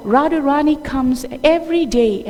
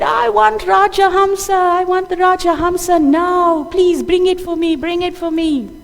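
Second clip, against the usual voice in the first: put-on higher and louder, insistent little boy's demand; demanding child; 6 semitones higher, 4 dB louder than usual. Krishna demands the moon from the gopis, insistently loud. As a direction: stamp your foot for the moon.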